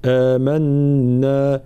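"آمنا" very slowly with the full lengthened vowel; correct long vowel. Shown as a demonstration. Teacher correcting the shortened vowel, slow. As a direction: hold the madd of "آمنا" fully. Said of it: "هكذا".

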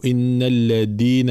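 الذين wrongly said with a hard "d" for ذ; slow recitation, turn the ذ of الذين into د; dhal as dal. Shown as a demonstration. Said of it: "وكأنها دال".

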